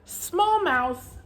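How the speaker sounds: high little voice for small. Shown as a demonstration. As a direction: say small mouse high and light. Contrast reading small and big.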